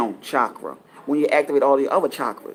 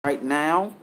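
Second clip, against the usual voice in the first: usual speaking voice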